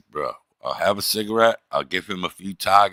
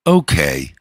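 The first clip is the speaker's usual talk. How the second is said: loud, low, loaded okay; unnerving okay; 4 semitones lower, 6 dB louder than usual. Retold or imitated. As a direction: imitate an okay that sounds like a threat. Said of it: "the way he said, "Okay," made me nervous".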